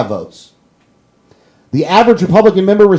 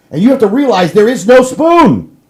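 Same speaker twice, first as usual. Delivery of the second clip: ordinary talk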